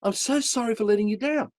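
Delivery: high-pitched, imitating a crying woman; retold tearful plea; tearful apology. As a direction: voice a weeping apology, pitched well up. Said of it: "And she's crying".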